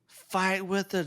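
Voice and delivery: a held-back imitation of a rallying cry; mild mock scream. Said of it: "just screaming".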